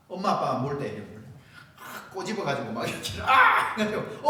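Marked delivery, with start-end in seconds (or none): 3.2-3.7 put-on loud, high cry of a pinched child; mock yelp of pain. Preacher acting out a pinched child, mock yelp.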